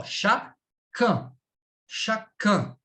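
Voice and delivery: slow chacun, ending in a nasal vowel; demonstrated pronunciation. Shown as a demonstration. Teacher models the masculine form, slow demonstrated pronunciation. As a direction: sound out "chacun" with a nasal UN, no hard N. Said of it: "Lembra lá, número um".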